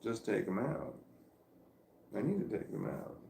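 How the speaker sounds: usual speaking voice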